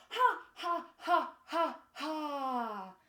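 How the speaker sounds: loud, punched "ha ha" from the belly. Shown as a demonstration. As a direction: laugh mid-high, last one drawn out. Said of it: "It's even funnier now".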